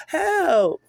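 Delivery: high, loud scream for help; terrified scream. Acted. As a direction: scream for help in sheer panic. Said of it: "He screams".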